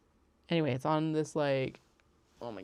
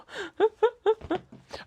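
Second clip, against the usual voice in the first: loud, high-pitched run of laughter; high giggle; 9 dB louder than usual. Real, not acted. Vlogger amused by own yes-and-no answer, high giggling. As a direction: burst into real high giggles.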